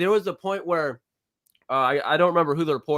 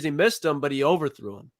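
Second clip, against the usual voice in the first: normal talking voice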